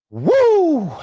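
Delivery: a high, loud "woo" of release after a long speech; relieved whoop. Real, not acted. Host relieved after a long speech, high loud whoop. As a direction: let it out, high and loud, glad it's over. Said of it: "That was a mouthful".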